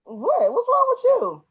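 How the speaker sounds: rising high puzzled what's wrong; sharply rising what's-wrong-with-you; confused what. Retold or imitated. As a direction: ask it high and baffled. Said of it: "I was looking at him like".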